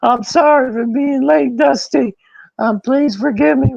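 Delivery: very high, squeaky pleading; desperate apology, hammed up; mock begging. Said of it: "down on his knees".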